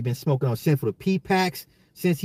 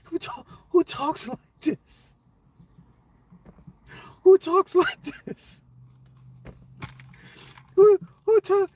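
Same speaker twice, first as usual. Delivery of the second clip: very high falsetto shriek of disbelief; helpless high-pitched outburst, breaking off; incredulous squeal; 4 dB louder, slower than usual. Real, not acted. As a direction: squeal it as if you can't get over it. Losing it over the quote.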